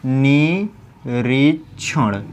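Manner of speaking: निरीक्षण very slowly as नी, री, क्षण; syllable by syllable. Shown as a demonstration. Teacher spelling it out for students, slow निरीक्षण. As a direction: hold and part the syllables of निरीक्षण. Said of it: "क्या हो जाएगा".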